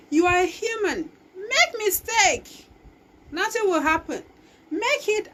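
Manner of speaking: rally them like a coach; loud exhortation. Real, not acted. Speaker urging listeners not to fear mistakes, loudly.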